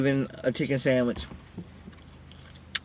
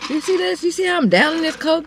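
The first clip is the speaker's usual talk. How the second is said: excited; 11 semitones higher than usual. Real, not acted. Excitedly showing drink.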